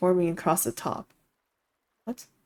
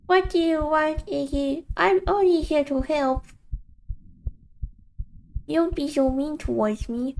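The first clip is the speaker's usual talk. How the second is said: much higher, sing-song taunting voice; mocking inner voice; 9 semitones higher than usual. The voice in his head greets Iggy, teasing and high. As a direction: tease him like a cruel old friend.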